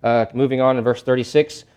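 usual speaking voice

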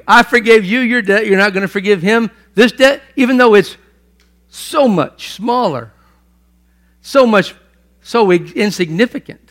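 loud, high scolding; king scolding the servant over the small debt; indignant king. Retold or imitated. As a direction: lay into the servant. King rebukes unforgiving servant.

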